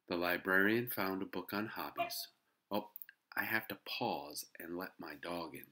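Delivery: ordinary delivery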